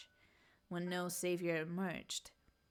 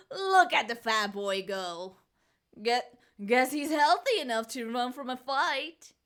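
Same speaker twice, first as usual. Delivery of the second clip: louder, gloating granny voice; mocking old woman; 5 semitones higher, 13 dB louder than usual. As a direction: jeer after the fleeing boy. Grandmother mocking fleeing boy.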